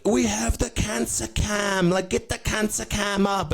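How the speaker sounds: very high, drawn-out mock singer voice; put-on band frontman. Coldplay to the concert crowd, high-pitched parody announcement. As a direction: announce it in a high, silly stage voice.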